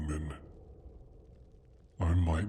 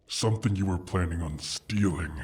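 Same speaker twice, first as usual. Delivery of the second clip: ordinary delivery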